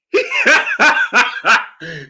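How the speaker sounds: burst of laughter. Real, not acted. Host cracking up over Bob's comment, helpless laughter.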